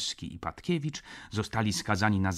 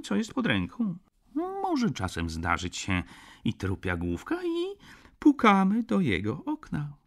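sweetly reasonable, raised voice; 8 semitones higher, 7 dB louder than usual. A narrator's reading. Lodger explaining the window knocking to the judge, sweetly. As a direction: explain it all with mock innocence. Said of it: "Zakończył tak słodkim tonem".

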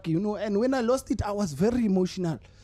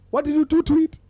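mock outrage; 5 semitones higher, 4 dB louder than usual. Outraged car was scrapped.

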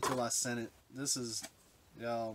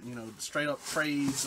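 ordinary talk

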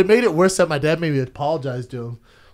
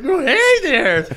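high, slow, sing-song hello from the mascot; hammed-up friendly mascot voice, higher and louder; cheery mascot greeting; 11 semitones higher, 5 dB louder, slower than usual. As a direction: greet a kid like a friendly costumed robin. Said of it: "He's like".

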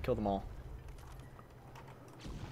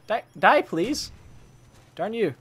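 loud, high, pleading shout at the enemy; desperate yell; 9 semitones higher, 8 dB louder, slower than usual. Real, not acted. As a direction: beg the enemy to die, loudly.

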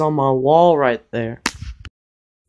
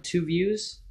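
ordinary delivery

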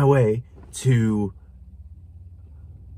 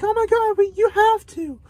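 squealing falsetto, fast and breathless; mock the pushy friends in a shrieking high voice; over-the-top girly squeal begging; mock gushing friends. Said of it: "But they were like".

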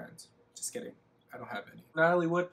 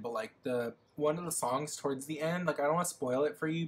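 ordinary talk, nothing marked

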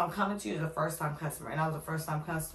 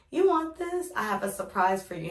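put-on high, louder child's offer; imitating a small boy handing over a surprise; little boy voice; 5 semitones higher, 4 dB louder than usual. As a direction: ask it like an eager little boy with a gift.

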